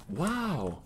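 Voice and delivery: Owen Wilson. Retold or imitated. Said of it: "I'm Owen Wilson".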